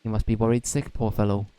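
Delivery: put-on lightly Welsh-accented fairy sidekick, chatty; Welsh Drippy voice. Drippy chats to Oliver in a mild Welsh accent. Drippy urges returning pot.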